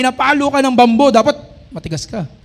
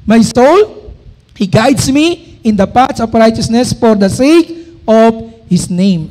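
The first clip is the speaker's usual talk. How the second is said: slow, loud, solemn verse reading; scripture reading; 4 dB louder, slower than usual. Acted. Preacher proclaiming a verse to the congregation, slow and loud. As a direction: proclaim each word of the verse slowly.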